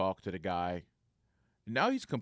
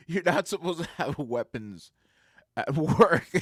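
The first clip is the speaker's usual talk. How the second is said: loud, higher, cracking up by the end; breaking into laughter; 6 semitones higher, 8 dB louder than usual. Real, not acted. Telling the guard no weapons at work, cracking up. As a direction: try to finish the line through real laughter.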